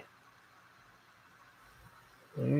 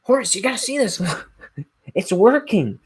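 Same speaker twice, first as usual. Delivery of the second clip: much higher, thin excited voice breaking into a laugh; excited scientist; 9 semitones higher, 5 dB louder than usual. Retold or imitated. Man calls his assistant Horace, higher and excited. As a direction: squeal it like a thrilled inventor. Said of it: "he's like".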